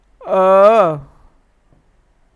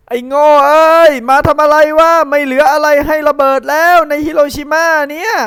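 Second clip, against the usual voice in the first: angry shout; 4 semitones higher, 5 dB louder than usual. Acted. Boy Gen yelling up at the bombers, angry shout. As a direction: yell it up and louder, furious.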